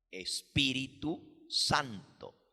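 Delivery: usual speaking voice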